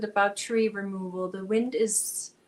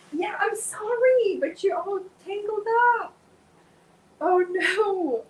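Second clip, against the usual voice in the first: high pet talk; 10 semitones higher, 6 dB louder than usual. Real, not acted. Coaxing her tangled cat in high, louder baby talk. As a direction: coo a high apology to a pet.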